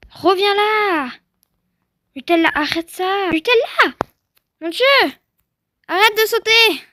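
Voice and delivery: building from a loud call to a high frantic yell; frantic high shouts of stop; panicked shouting. Real, not acted. Horse jumping wildly, panicked yelling.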